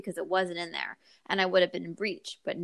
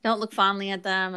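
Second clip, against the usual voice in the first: ordinary talk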